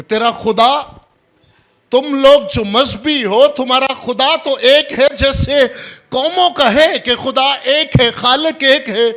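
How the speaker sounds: very high, loud, strained-up proclamation; full-force preaching. Real, not acted. Preacher rebukes divided loyalties, loud and high. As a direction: cry it out to the congregation.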